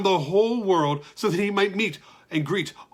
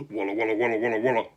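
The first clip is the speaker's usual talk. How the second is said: crowd murmur; 6 semitones lower, much faster than usual. Acted.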